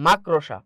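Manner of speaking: মাকড়সা said as "mak-ro-sha", r before the o; common mispronunciation. Shown as a demonstration. Teacher showing how many people say it, মাকড়সা mispronounced as "makrosha". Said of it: "অনেকে আমরা উচ্চারণ করে থাকি".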